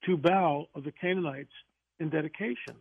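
ordinary talk, nothing marked

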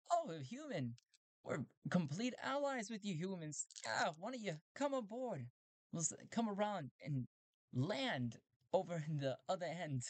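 high cheerful robot voice; friendly robot. Acted. Robots inviting Talty aboard, high friendly voice.

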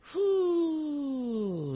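whew. Real, not acted. Stressed exhale about work.

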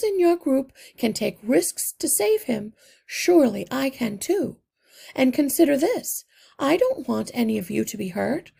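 a little mother pleading her case firmly; mother mouse. A narrator's reading. Mother mouse insists to Justin she can go, earnest plea. Mrs Frisbee's pleading speech.

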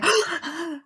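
sharp startled gasp, high; dramatic gasp. Retold or imitated. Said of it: "seriously dramatic jump back gasp".

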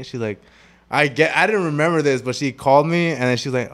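ordinary delivery

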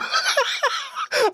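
high-pitched laughter. Real, not acted. Laughs after imitation.

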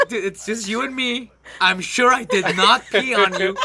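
building from calm to a loud laughing protest; incredulous rant. Retold or imitated. Telling roommate nobody else was home, laughing incredulous. Retold indignant reply to friend.

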